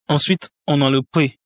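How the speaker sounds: normal talking voice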